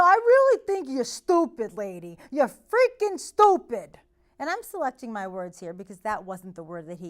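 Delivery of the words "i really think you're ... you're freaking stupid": loud, higher mock shouting; angry insult. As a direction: jab each word at the girl. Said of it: "I know".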